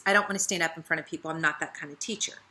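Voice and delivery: soft, fast, reluctant mumble; timid excuse. Retold or imitated. Downline leader dodging teaching again, soft fast timid excuse. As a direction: brush it off quickly and shyly. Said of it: "that was her excuse".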